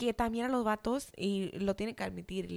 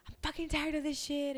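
retold outburst; 7 semitones higher than usual. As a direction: re-enact blowing up, without shouting. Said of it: "And I busted out".

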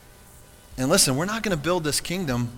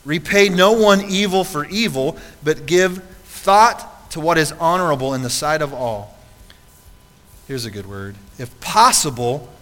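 loud, slow preaching of scripture; 4 semitones higher, 8 dB louder, slower than usual. Acted. Pastor reading Romans to the church, preaching loud and slow. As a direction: proclaim the verses with full force, slowly.

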